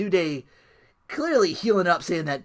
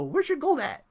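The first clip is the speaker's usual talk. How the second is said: higher, teasing "where's your gold" taunt, slowing at the end; mock Kurt Angle taunt; 5 semitones higher, slower than usual. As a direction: needle them like a cocky wrestler. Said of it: "Kofi pulling a Kurt Angle".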